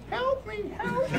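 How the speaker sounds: very high, tiny squeaky cry for help; a tiny trapped magician calling help. Acted. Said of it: "My impression of a tiny magician".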